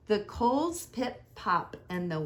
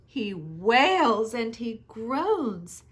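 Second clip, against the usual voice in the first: ordinary delivery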